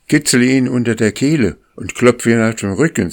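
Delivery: put-on the old leader goose ordering the boy to save the gander; urgent command. A narrator's reading. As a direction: snap out instructions in an emergency. Akka orders urgent rescue.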